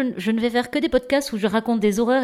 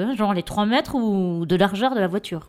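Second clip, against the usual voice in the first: usual speaking voice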